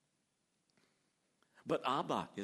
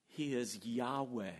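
ordinary delivery

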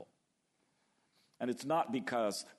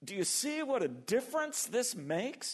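David exulting; 13 semitones higher than usual. Retold or imitated. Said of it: "David says".

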